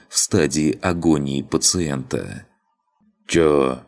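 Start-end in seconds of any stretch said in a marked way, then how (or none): 3.3-3.8 put-on creaky drunk grunt. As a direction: croak it out hoarsely. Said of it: "скрипучим голосом изрекло тело".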